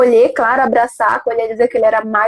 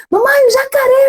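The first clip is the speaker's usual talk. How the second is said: voz de menino pequeno, bem mais aguda; criança chamando a mãe; 12 semitones higher than usual. Retold or imitated. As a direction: chame a mamãe como uma criança com medo. Filho chama a mãe com medo. (voz aguda de criança assustada)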